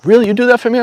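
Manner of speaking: grateful friend hardly believing the offer; surprised friend. Retold or imitated. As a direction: act out the friend's happy shock. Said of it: "He was like".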